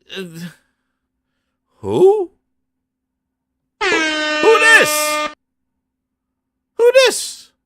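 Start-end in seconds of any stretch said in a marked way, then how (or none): 1.8-7.5 high, loud squealed question; baffled outcry. Retold or imitated. Stunned viewer asking about the stranger, baffled squeal. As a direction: squeal each one, hammed-up. Baffled reaction to reveal repeated.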